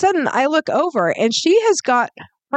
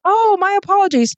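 mock apology; 10 semitones higher than usual. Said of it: "And she's like".

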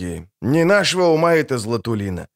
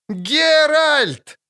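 put-on high, thin piercing call of a little girl; a small girl shrieks his name across the yard; piercing child's cry. A narrator's reading. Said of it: "кричит, тоненько, пронзительно".

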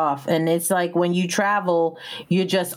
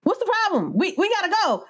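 much higher, frustrated pushing; high exasperated retold demand; impatient; 13 semitones higher than usual. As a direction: hurry them up, exasperated. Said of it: "it was like".

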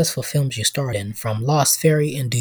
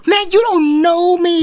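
high, slow squeaky voice; slower than usual. Acted. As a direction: answer in a thin high squeak. Host replies in squeaky voice.

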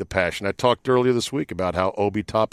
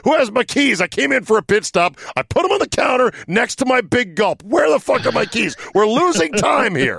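hammed-up frantic racer yelling at the pit crew; Scott Dixon; 12 semitones higher than usual. Host mimics driver who lost his keys, mock frantic. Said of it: "how could Scott Dixon lose his keys".

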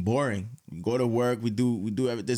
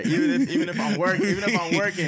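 louder and higher with each repeat; building excitement; 6 semitones higher, 4 dB louder than usual. Acted. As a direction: hype yourself up, climbing. Hyping himself up, repeated catchphrase.

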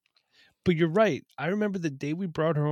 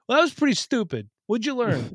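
higher and louder stern dad lecture; sounding like my father; 10 semitones higher, 5 dB louder than usual. Retold or imitated. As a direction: scold like your own father would. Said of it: "I sound like my father".

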